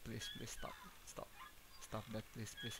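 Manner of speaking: soft whispered begging; real scared whisper; whispered plea. Real, not acted. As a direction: whisper it, frightened. Pleading with creepy game figure.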